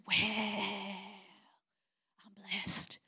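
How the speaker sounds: soft, breathy-quiet mock humility; fake humble hush. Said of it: "How you doing, sister?".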